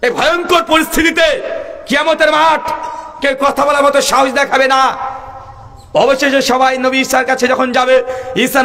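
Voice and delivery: sustained, ringing preacher's cry; full-force preaching. Real, not acted. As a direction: call each name out high, rising then falling. Preacher calls out prophets' names.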